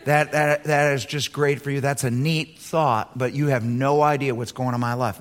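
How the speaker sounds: low, dismissive voice; sceptical listener. Pretend. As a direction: brush Paul off, unimpressed. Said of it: "You could say".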